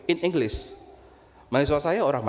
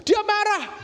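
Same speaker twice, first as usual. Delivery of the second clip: higher and louder "Dia marah", punched hard; loud emphasis; 7 dB louder, slower than usual. Retold or imitated. Preacher tells congregation brother got angry, loud. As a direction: bang out "Dia marah" with force.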